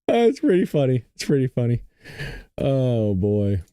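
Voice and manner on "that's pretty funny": ordinary talk, nothing marked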